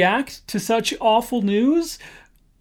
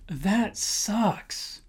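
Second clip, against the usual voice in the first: casual disgust; 2 semitones higher, 8 dB quieter than usual. Shown as a demonstration. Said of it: "my personal favorite".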